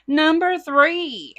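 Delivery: slow, sing-song announcement; drawn-out announcement. Acted. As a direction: stretch it out like a host. Announcing next item.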